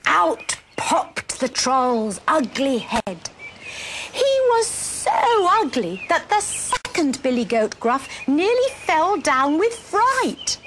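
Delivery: slow, rising suspense in picture-book telling; dramatic children's storytelling. A narrator's reading.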